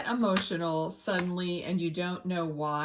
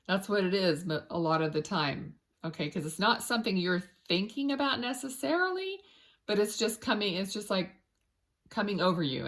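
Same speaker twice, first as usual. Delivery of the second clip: ordinary delivery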